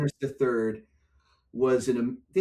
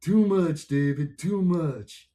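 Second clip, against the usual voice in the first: lightly voiced Richie; 4 semitones higher, 3 dB louder, much slower than usual. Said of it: "this is what I hear".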